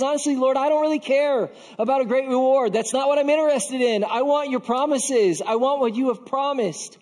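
frustrated, emphatic pleading held high; insistent plea. Acted. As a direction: press your case urgently to God. Abraham demands God's promises.

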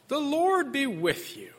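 high, sneering mock blessing; sarcastic. Shown as a demonstration. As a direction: drip the words with scorn. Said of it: "There's this sarcasm that I, uh, tried to bring out just with my voice".

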